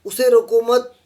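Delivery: उसे रोको मत high and emphatically loud, halting on मत; pause after मत. Shown as a demonstration. Teacher repeating the example for students, loud stop after मत. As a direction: punch रोको मत, then stop for the comma. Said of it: "विराम चिन्ह दिया है".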